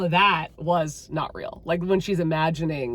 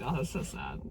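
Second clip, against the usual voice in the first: mock sorrow; 6 semitones lower, 12 dB quieter than usual. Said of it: "I'm like".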